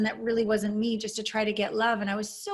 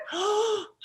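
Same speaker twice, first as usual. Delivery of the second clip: sharp gasp; 10 semitones higher than usual. Retold or imitated. Acting out comforting inner child.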